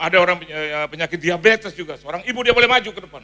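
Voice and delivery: loud, ringing pulpit proclamation; booming word of prophecy; prophetic call. Retold or imitated. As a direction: call it out to the whole church. Preacher calling out prophecy.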